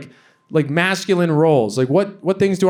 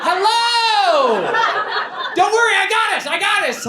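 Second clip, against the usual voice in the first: very high, hammed-up squeaky voice; comic high-pitched cheerful hailing; eager talking body part. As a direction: call out like a tiny overeager rescuer. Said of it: "my penis is like".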